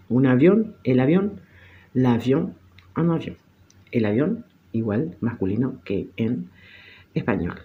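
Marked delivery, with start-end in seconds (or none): none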